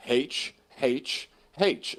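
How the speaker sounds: "H" said as "haitch", quoting a presenter; deliberate "haitch" for each "H"; aspirated H. Retold or imitated. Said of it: "and the acronym is".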